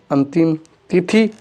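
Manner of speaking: ordinary talk, nothing marked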